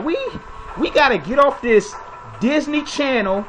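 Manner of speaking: ordinary talk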